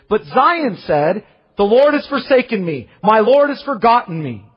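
loud, ringing proclamation of Isaiah; fervent scripture reading. Acted. Preacher reading Isaiah to congregation, loud and fervent. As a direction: declaim it like a prophet.

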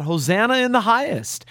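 retold crying out. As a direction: quote a crowd's cry with pitch lifted, volume held back. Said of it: "those who cried out".

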